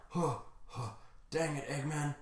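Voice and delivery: acted exhaustion, hushed and puffing; winded and hurt. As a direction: curse him between tired breaths. Wounded hero panting at villain.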